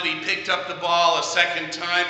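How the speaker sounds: usual speaking voice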